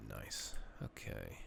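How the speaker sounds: normal talking voice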